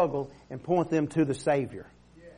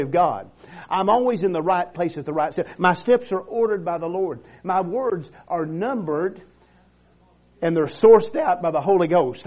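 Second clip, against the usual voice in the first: full-force preaching; 2 semitones higher, 5 dB louder than usual. Acted. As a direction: build the church up with every line.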